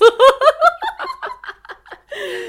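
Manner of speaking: loud squealing laugh; high laugh. Real, not acted. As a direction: burst into a shrieking laugh. Laughing at zombie joke.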